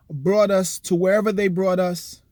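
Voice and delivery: ordinary delivery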